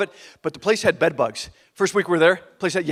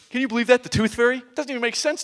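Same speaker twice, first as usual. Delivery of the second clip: put-on higher-pitched, incredulous child voice; scornful older kid; 6 semitones higher than usual. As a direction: scoff like a ten-year-old who knows better. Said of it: "what a little baby his brother is".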